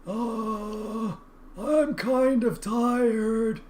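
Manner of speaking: slow, drawn-out weary river spirit; sleepy river voice. Acted. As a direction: yawn it out as a lazy river.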